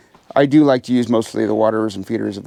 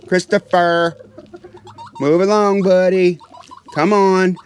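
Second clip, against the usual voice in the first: raised, high, insistent calling; firm call to a rooster; 6 semitones higher than usual. Real, not acted. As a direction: stand your ground and order the bird back. Shooing a rooster from door.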